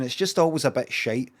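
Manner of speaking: usual speaking voice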